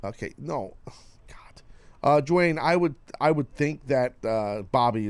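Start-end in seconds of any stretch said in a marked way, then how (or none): 0.0-1.6 exasperated whisper under breath. Real, not acted. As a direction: sigh it out in a whisper.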